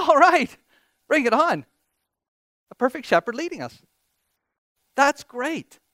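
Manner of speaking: high, loud burst of delight; thrilled, high-pitched welcome of good news; excited cheer. Acted. Preacher acts out his delighted reaction.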